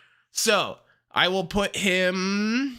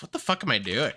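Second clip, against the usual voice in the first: usual speaking voice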